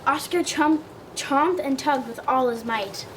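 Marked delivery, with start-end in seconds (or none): none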